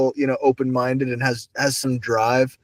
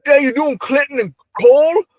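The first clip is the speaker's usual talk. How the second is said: put-on much higher, louder old-man call; grandpa holler; 11 semitones higher, 5 dB louder than usual. Grandpa calling Clinton and Cole outside. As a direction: yell both names out the door. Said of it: "he'd be like".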